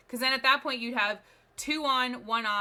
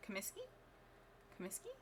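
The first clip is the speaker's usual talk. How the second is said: muttered to self; 2 semitones lower, 20 dB quieter, faster than usual. Shown as a demonstration. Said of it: "I'm sure I mispronounced half of that".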